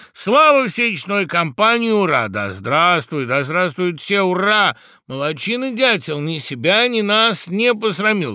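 high, loud crowd hurrahs; shouted cheers. A narrator's reading. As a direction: cheer the whole company on. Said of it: "кричали птицы".

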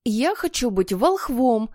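slow, lightly voiced boy's demand; Ralph's curt shout. A narrator's reading. Ralph claims a part, mild shout. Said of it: "крикнул резкий Ральф".

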